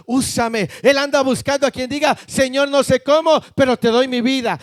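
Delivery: rapid, insistent pulpit litany; impassioned preaching. Real, not acted. Preacher urging people to offer God their lives, impassioned preaching. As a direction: pour out each phrase with mounting fervour.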